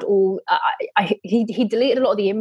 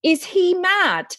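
much higher, slow incredulous "Is he mad?"; disbelief; 10 semitones higher, slower than usual. Retold or imitated. Shop assistant stunned by the husband, high slow disbelief. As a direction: gasp it out in shocked disbelief. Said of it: "And he was like".

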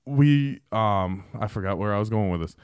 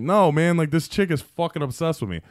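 higher, emphatic retold insistence; exasperated retelling, pitched up; insistent protest; 7 semitones higher than usual. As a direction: act out pleading to be believed. Retold protest to doubting coworker.

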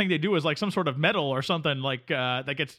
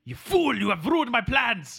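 shouted, higher, hammed-up villain; a cartoon nemesis thwarted, shouting in mock fury; mock villain rage; 5 semitones higher, slower than usual. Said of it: "Quebecois Dave".

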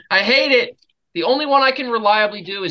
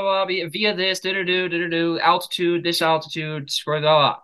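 normal talking voice

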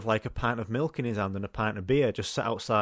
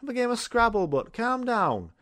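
teasing; 12 semitones higher than usual. Retold or imitated. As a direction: stretch "calm down" out to wind him up. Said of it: "I was like".

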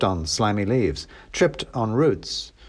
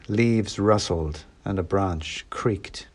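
ordinary delivery, nothing marked

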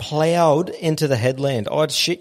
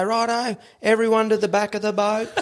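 a higher, lightly voiced crew order; 9 semitones higher than usual. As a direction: play the deckhand sending everyone aft. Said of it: "they go".

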